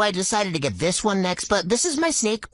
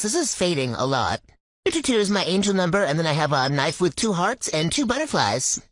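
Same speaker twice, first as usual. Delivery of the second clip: usual speaking voice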